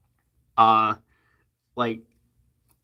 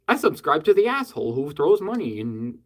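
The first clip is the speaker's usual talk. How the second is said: usual speaking voice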